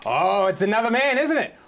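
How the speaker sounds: accusing jealous husband. Acted. Husband confronting his wife over packed bags, mock outraged. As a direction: play a wronged husband working up to the worst suspicion.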